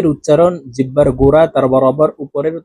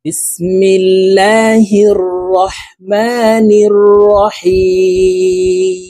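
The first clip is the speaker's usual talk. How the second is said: slow, melodic, higher chanted recitation; Quran recitation; 7 semitones higher, 4 dB louder, much slower than usual. Acted. Teacher to viewers, reciting the whole sura in one go. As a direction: chant the sura slowly, stretching the vowels.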